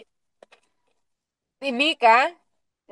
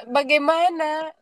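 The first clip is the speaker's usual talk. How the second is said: ordinary talk, nothing marked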